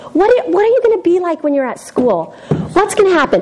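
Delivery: ordinary talk, nothing marked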